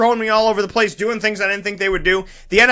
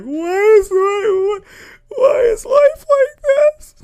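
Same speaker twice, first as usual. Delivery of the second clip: mock wail; slower than usual. In the theater after the movie, wailing in hammed-up grief. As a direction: wail at the heavens like a crybaby. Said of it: "I'm sitting there like".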